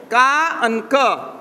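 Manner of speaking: ka and ka drawn out very slowly; slow demonstration. Shown as a demonstration. Teacher slowly pointing out letters on the board, ka and ka. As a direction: stretch ka and ka so each syllable is clear. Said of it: "Right here".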